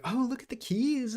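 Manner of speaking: put-on high sing-song baby talk; cooing to a baby. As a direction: coax the toddler with a high coo. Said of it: "and I'll be like".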